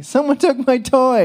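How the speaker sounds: put-on child complaining to mom about a toy; upset little kid. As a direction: play a small child wailing over a toy. Child voiced in a story.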